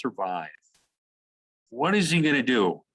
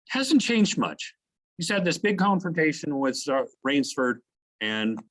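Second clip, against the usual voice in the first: normal talking voice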